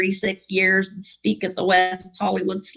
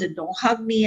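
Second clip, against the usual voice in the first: ordinary delivery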